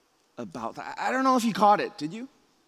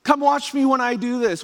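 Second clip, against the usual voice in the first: high, eager calling for attention; pleading child; 9 semitones higher, 5 dB louder than usual. Acted. Child to parents wanting to be watched, high eager pleading. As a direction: beg them to look, pitched right up.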